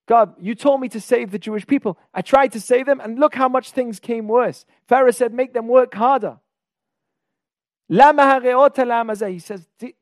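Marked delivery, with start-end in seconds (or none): 0.1-6.4 higher, louder protest to God; hard words. Acted. Moses turns to God after the people suffer, hard protest. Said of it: "hard words to God". 7.9-9.3 loud, high cry of protest; hard words. Acted. Moses quotes his challenge to God in Hebrew, hard protest. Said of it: "hard words to God".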